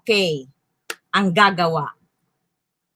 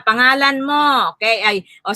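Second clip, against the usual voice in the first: normal talking voice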